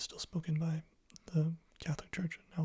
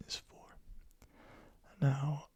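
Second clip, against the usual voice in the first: ordinary talk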